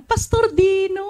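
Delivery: high, bright-eyed call of his name; excited greeting. Retold or imitated. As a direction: greet him like a starstruck fan. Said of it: "Parang ganun". (masayang pagbati, mataas ang boses)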